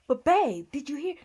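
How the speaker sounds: loud, high-pitched imitation of an insistent wife; mock eager pleading. Wife pressing on after being told to stop, mock eager. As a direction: burst in, pressing him to listen.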